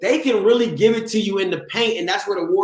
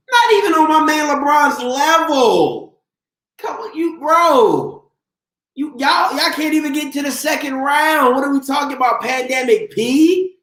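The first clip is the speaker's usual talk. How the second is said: heated rant; 8 semitones higher than usual. Real, not acted. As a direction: yell it at the haters, pitched way up. Yelling at chat about rival team.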